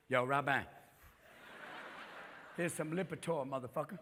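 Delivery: low, soft dealer voice; hushed, low mock drug dealer; doctor as dealer. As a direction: push samples quietly like a street dealer. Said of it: "my doctor's my dealer now".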